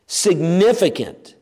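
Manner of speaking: ordinary talk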